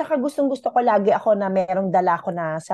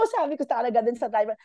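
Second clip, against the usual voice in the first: ordinary talk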